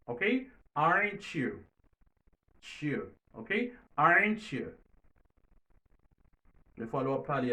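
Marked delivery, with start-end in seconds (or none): 0.7-1.6 "Aren't you" said as "arenchu", t and y fused into ch; t-y linked as ch. Shown as a demonstration. Teacher shows how t before y turns into ch: "Aren't you" as "arenchu". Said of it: "Se sa ki fè isi nou di". 4.0-4.7 "Aren't you" said as "arenchu", t and y fused into ch; t-y linked as ch. Shown as a demonstration. Teacher shows how t before y turns into ch: "Aren't you" as "arenchu". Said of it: "Se sa ki fè isi nou di".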